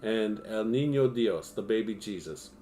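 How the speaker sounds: projected, scripted storyteller delivery; true-crime narration. A narrator's reading. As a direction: read it out like a documentary script.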